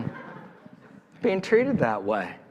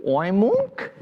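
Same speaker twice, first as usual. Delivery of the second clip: pig attempting oink; 5 semitones higher than usual. A narrator's reading. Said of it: "She listened to the cow".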